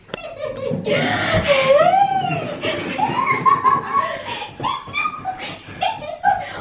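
very high excited squeals and laughter; shriek-laugh with delight; delighted squealing laugh. Real, not acted. Owner reunited with her dog, squealing laughter.

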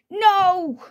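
high, drawn-out wail of protest; play-acted villain's defeat cry; mock despair. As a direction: wail it as you're carried off. Villain sent to jail.

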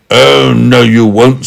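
loud, firm refusal. A narrator's reading. Big man refusing Meddle's promise, firm. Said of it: "said the big man firmly".

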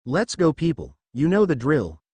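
ordinary delivery

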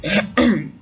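short, voiced throat clear. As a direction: clear the throat before speaking. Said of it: "He cleared his throat".

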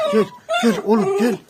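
high-pitched, laughing call to the dog; high coaxing call. Real, not acted. Owner coaxing his dog to come, high and laughing. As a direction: coax the dog over in a high sing-song.

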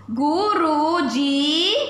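Guru drawn out slowly, each syllable clear; slow reading aloud. Shown as a demonstration. Teacher modelling a phrase to young learners, slow. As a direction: spell it out in sound for the kids. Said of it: "तो गुरु हो जाएगा".

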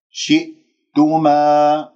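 شئتما slowly, a silent glottal hold before tuma; locked hamza. Shown as a demonstration. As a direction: shut off the sound after shi in شئتما. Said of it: "تنتبه بقفل الأوتار الصوتية". (قفل الهمزة الساكنة في شئتما)

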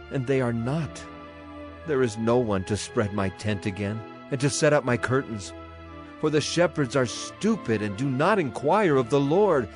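ordinary talk, nothing marked

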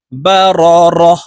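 slow "বা র রহ" ending in a breathed h; waqf reading. Shown as a demonstration. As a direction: stretch it, then stop on the h of "রহ". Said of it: "ওয়াকফের ক্ষেত্রে গোলতা হার উচ্চারণ করব". ("রহ"-এ হা সাকিন করে থামা)

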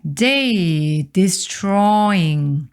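destroying drawn out slowly; slow careful teacher reading; slow model reading. Shown as a demonstration. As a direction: read it slowly for learners. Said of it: "slow model reading".

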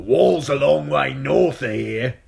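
loud, high jeering spearman; mocking. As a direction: jeer down at the old man.

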